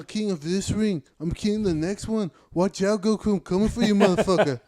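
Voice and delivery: hammed-up call-out of the next rival; taunting fighter.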